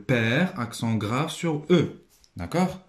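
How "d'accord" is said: ordinary delivery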